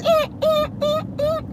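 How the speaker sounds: high, repeated mock stabbing noise; short squeaked horror sting; horror-movie stabs. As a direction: imitate slasher music with short eh's. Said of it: "screaming like Jason is behind him".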